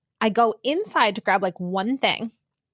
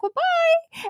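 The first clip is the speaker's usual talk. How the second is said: higher-pitched, retold flustered sign-off; awkward goodbye. She retells leaving the police officer, awkward high goodbye. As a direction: blurt an over-cheery bye.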